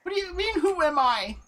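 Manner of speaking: put-on high, fretful old woman; man doing granny voice. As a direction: play a lost old lady.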